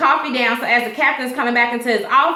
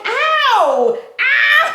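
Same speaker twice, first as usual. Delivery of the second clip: mock ow; 12 semitones higher than usual. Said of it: "He was like".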